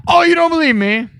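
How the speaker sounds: high-pitched, rising challenge; playful high "oh, really?" tone; mock indignation. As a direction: act affronted at being doubted. Mock challenge to doubting audience.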